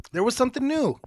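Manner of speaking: high, loud mock voice; high squeaky. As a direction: squeak it out dramatically. Exaggerated high repeat.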